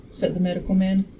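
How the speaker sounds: ordinary delivery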